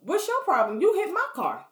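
high, rising confrontation; getting heated with the driver; upset. Retold or imitated. She confronts the man who hit her car, upset. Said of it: "I'm getting upset now".

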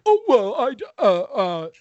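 high, flustered stammering; nervous stammer. Retold or imitated. Caller caught in his lie, flustered mock stammer. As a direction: fumble the words like you're caught out.

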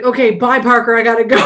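mock hurried sign-off. Co-host mimics Booth hanging up on his son, mock hurried. Acting out hurried phone hang-up.

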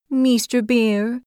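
small creature asking meekly; a tiny creature's shy voice; timid hedgehog. Acted. Hedgehog addresses bear.

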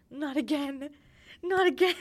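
anguished wail. Retold or imitated. Girl dumped by a paper note, wailing in mock despair.

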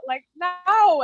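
high, loud mock wail; retold crying. Crying diner answers the waitress, retold tearful wail. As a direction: wail it, imitating yourself in tears. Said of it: "she just let me cry".

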